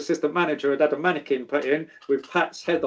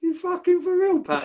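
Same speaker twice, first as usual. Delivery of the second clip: much higher, laughing disbelief; incredulous. Retold or imitated. Hearing the bouncer is armed, a high incredulous cry. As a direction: squeak it in disbelief.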